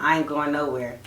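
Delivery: low, dragged mock of the guy; imitating his low mumble. Mocking how he brushed her off. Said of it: "The way he said it".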